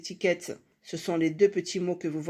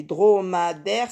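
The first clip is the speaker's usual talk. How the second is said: DROMADAIRE slowly stretched into dro, ma, daire; syllable by syllable; 4 dB louder, slower than usual. Shown as a demonstration. Teacher showing it has several syllables, syllable by syllable. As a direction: draw out each syllable of "dromadaire". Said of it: "on a plusieurs syllabes".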